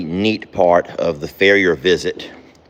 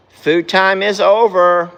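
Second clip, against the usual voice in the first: drawn-out, louder call; slow firm call; 9 semitones higher, 5 dB louder, slower than usual. Real, not acted. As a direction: stretch it out and insist. Repeated call, slower and louder.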